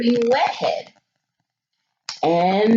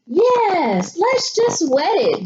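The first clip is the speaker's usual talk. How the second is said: higher, excited chorus; eager agreement; 8 semitones higher, slower than usual. A narrator's reading. Family agreeing on the plan, eager and higher. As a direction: agree all at once, eagerly. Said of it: "they all agreed".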